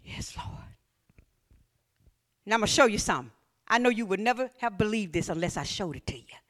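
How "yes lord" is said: hushed whispered yes. Acted. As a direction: breathe it out in a whisper. Whispered surrender.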